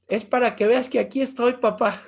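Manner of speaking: laughing through the son's answer; a father retelling his son's reply with laughter. Retold or imitated. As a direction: giggle while voicing the boy. Son explains, teller laughing.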